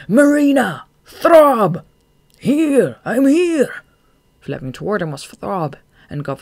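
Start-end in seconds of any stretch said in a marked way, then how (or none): 0.1-3.8 high, loud calls, each one falling; cried out. A narrator's reading. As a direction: shout to far-off companions. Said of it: "he cried".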